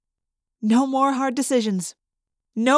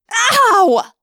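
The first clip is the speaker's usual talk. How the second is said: pained yell; 12 semitones higher, 9 dB louder than usual. A narrator's reading. Hand slapped against a tree.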